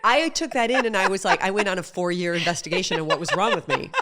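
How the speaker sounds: high, loud, laughing through the words; real laughter. Real, not acted. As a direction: laugh as she tells it. Laughing while telling story.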